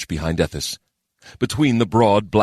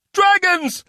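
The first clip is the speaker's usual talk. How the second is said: very high alarmed shout; war mage shouting from the gallery; 3 dB louder than usual. A narrator's reading. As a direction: cry out the warning. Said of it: "shouted a war mage".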